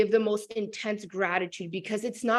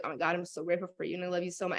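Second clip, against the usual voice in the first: tender thanks; 4 semitones lower, 7 dB quieter, much faster than usual. Retold or imitated. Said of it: "I hug him every morning and I'm like".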